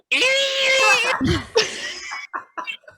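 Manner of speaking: very high, drawn-out imitated squeal. Player closing the squeaky door makes its noise, high squeal. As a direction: screech like an ungreased door, half laughing.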